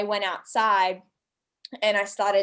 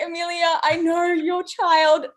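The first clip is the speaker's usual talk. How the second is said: exasperated; 7 semitones higher, 4 dB louder than usual. Retold or imitated. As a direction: whine it half asleep. Groggy complaint to friend.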